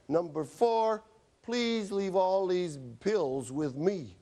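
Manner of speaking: the final order, read out slowly and deliberately; slowly dictating the last order to the patient; slow prescription. Acted. Writing the prescription out loud.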